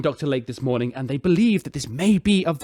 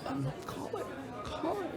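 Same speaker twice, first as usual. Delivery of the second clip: whispered, much softer sigh of a name; whisper, crushed by the question; despairing whisper; 11 semitones higher, 18 dB quieter than usual. Acted. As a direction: breathe the name out in defeat. Captain despairs to host.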